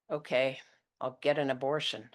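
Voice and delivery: plain reading voice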